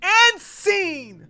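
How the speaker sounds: high, loud scene-ender; theatrical wrap-up yell; mock director's call. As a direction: snap out of the act with a shout. Loudly ends the crying act.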